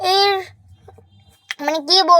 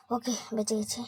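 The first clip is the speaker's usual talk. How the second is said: relieved whisper; 5 semitones lower, 15 dB quieter than usual. Real, not acted. Relief whisper after hiding.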